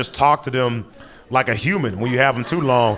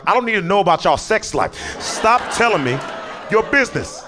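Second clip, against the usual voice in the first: high, raised exasperated scolding; exasperated cop; 6 semitones higher than usual. Retold or imitated. Fed-up cop scolds the pair, raised and high.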